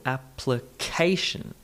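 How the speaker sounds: application broken slowly into syllables, CA strongest; ap-pli-CA-tion, measured; third-syllable stress. Shown as a demonstration. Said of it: "third syllable".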